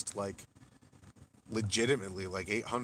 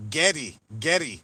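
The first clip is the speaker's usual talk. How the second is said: a light mock Scottish "Getty, Getty", higher and louder; mock Scottish accent; 5 semitones higher, 10 dB louder than usual. Streamer mocking chat's Scottish try at the name, light Scottish accent. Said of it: "trying to do the Scottish accent".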